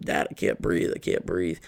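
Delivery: low, hushed plea; retold choking plea. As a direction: plead quietly, as if short of air. Retold plea while being choked.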